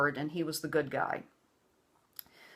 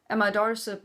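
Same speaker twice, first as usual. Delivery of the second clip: ordinary delivery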